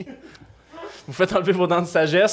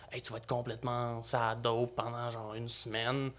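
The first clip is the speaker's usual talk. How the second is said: quiet mock warning; 3 semitones lower, 11 dB quieter than usual. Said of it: "ils te parlent".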